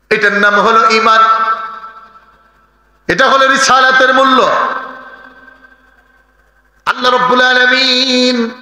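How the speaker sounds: high, half-sung sermon chant; chanted pulpit exhortation; melodic preaching. Real, not acted. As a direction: intone it high, holding the phrase endings.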